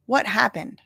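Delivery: ordinary talk, nothing marked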